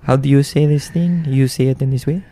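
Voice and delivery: put-on playfully indignant French accent. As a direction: ask it like a comic Frenchman. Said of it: "I like the French accent".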